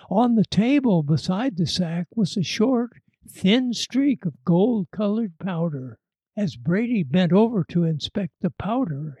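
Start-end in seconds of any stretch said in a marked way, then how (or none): none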